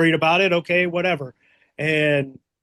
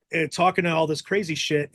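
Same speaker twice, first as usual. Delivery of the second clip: usual speaking voice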